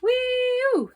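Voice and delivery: very high, loud gleeful whoop; thrilled passenger whooping; joyful whoop. A narrator's reading. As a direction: whoop like a kid on a ride. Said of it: "said Baby Lincoln".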